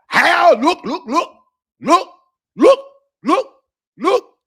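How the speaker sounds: high, stammering repeated look; squeaky halting look look look; Tim Bradley impression. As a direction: mimic a flustered commentator starting over and over. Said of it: "this is my Tim Bradley impersonation".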